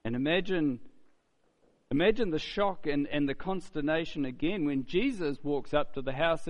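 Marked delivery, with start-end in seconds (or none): none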